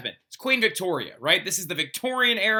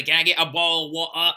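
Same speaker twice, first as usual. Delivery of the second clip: lightly mocking British "Can I get a bowl of water"; a hint of a mock British accent; 4 dB louder than usual. Teacher echoing the joke in a lightly mocking British accent.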